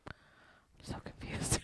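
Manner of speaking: confused whisper. Real, not acted. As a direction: whisper it to yourself. Confused by the game rules.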